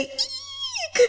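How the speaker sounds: high piercing squeal; fan squeal. Acted. A fangirl squeals at the psycho, shrill squeal.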